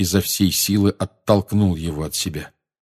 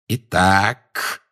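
menacing. A narrator's reading. Enraged Snape confronts Harry.

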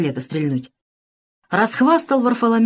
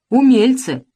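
«умельцы!» громко и высоко; выкрикнуть «умельцы!» с насмешкой; громко, насмешливо; 5 semitones higher, 5 dB louder, slower than usual. A narrator's reading. Разин насмешливо отвечает мальчишкам. (атаман громко насмехается над мальчишками)

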